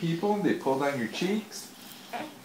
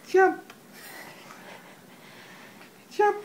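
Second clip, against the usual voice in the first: high squeal. Acted. Frog jumping game with baby.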